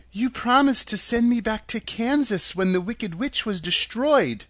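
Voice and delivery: put-on lifted girlish voice, insistent; young heroine Dorothy. A narrator's reading. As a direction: press the claim as an earnest girl.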